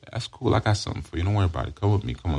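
acted smooth-talker soothing a runaway; coaxing predator. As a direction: lure them along gently and casually. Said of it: "cruise in the bus stations looking for runaways".

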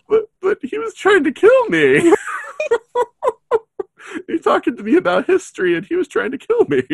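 high, fast, whiny protest through laughter; hammed-up high-pitched complaint; whiny indignant Ian. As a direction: squeal it like he can't believe it. Imagining poor Ian's indignant protest.

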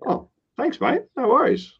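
high, bright-pitched happy customer reply; pleased customer thanking a friendly server, very high and cheerful; cheery thanks. Retold or imitated. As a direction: chirp it back like a delighted customer.

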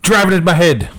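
put-on jokey Irish accent; mock Irish accent. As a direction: exclaim it in a hammed-up Irish accent. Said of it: "doing a fucking accent every time".

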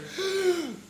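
sharp high gasp about to scream; imitated gasp. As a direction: mimic a startled sister's intake of breath. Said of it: "all I hear is".

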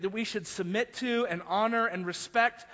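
ordinary delivery

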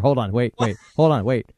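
usual speaking voice

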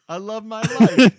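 goofy singsong rhyme; sing-song silly line. Pretend. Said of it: "my brain went".

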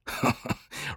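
low breath of scoffing laughter; scornful laugh. A narrator's reading. Travis dismissing Noscat's warning, scornful laugh. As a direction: chuckle dismissively.

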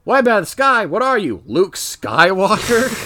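imitating a sarcastic retort, slowing on the punchline; mock incredulous comeback. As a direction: throw it back in mocking disbelief. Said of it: "And the other guy's like".

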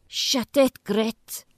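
sharp yell up from sleep; shout. Acted. Woken by a chainsaw, shouting at Grit to shut it. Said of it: "you shout".

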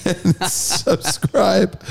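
high, slow, breaking into laughter; cracking up mid-sentence; laughing. Real, not acted. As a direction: laugh through a drawn-out word. Cracking up at own botched sign-off.

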